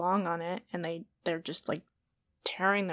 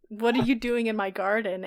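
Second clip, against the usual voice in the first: normal talking voice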